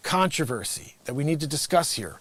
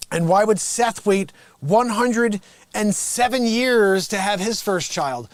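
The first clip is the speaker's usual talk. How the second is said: ordinary talk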